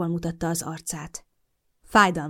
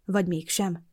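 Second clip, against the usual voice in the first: plain reading voice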